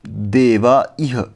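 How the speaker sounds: देवा इह said very slowly with a break between the vowels; careful Sanskrit pronunciation demo; slow example. Shown as a demonstration. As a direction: pull देवा and इह apart slowly. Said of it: "जैसे कि".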